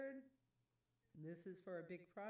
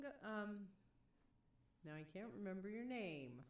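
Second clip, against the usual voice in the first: normal talking voice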